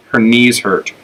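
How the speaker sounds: ordinary delivery, nothing marked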